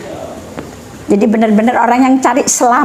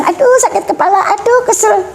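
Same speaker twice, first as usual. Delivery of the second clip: high-pitched mock grumble of aches; imitated complaining mother; 9 semitones higher than usual. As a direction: play a worn-out mum moaning, pitched high. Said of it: "ibunya bilang". (menirukan ibu mengeluh, nada tinggi)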